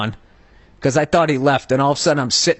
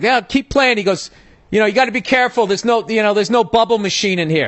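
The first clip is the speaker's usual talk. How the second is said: mocking taunt; 7 semitones higher than usual. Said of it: "He was saying".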